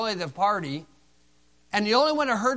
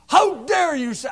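angry retort; 8 semitones higher, 7 dB louder, slower than usual. Retold or imitated. Angry inmate at the bars.

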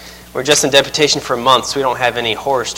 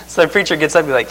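ordinary talk, nothing marked

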